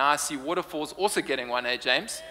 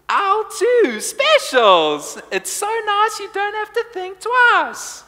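much higher and louder, slow sing-song announcer; intercom announcer jingle; 9 dB louder, slower than usual. Retold or imitated. Preacher imitates the supermarket announcer's slow jingle, high and loud. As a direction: drawl the specials jingle like the store intercom lady. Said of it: "you would hear this voice".

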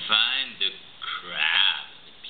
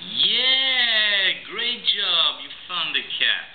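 excited praise; 8 semitones higher, much slower than usual. Real, not acted. Praising toddler's find.

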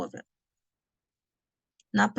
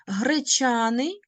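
slow demo word; 3 semitones higher, slower than usual. Shown as a demonstration. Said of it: "teacher demonstrates the word".

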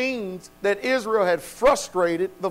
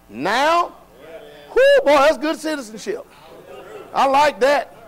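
higher and louder, jubilant preacher cry; preaching at full force; 8 semitones higher, 5 dB louder than usual. Acted. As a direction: lift the congregation with joy. Preacher building to a joyful peak.

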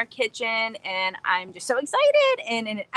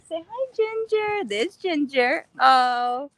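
talking to dog; 5 semitones higher, 3 dB louder, slower than usual. Real, not acted.

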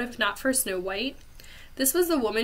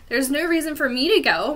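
louder, higher furious retort; 5 semitones higher, 6 dB louder than usual. A narrator's reading. Dragon Malevolent refusing to join the trip, furious. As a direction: spit it out like she wants to scream. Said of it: "Malevolent said furiously".

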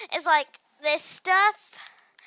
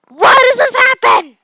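much higher, much louder frustrated cry; exasperated shout; 11 semitones higher, 19 dB louder than usual. Real, not acted. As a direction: shout it at the screen in despair. Falling again in the game.

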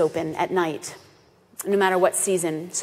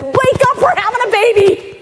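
very high, loud thrilled wake-up call; rousing the family for a birth; excited alarm; 8 dB louder than usual. Retold or imitated. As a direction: burst in with the big news. Waking family for calving.

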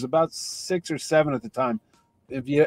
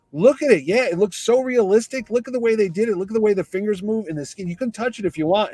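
higher, louder showman delivery; playful enthusiasm; 6 semitones higher, 3 dB louder than usual. Retold or imitated. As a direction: sell the fake hand like a proud showman. Mark Hamill playfully showing his hand.

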